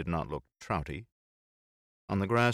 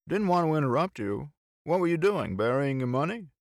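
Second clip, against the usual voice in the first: higher, louder than the narration; lively, high-pitched teasing questions; bantering friend, teasing; 9 semitones higher, 6 dB louder than usual. Acted. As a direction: call it out high and playful. Voices Bill in dialogue.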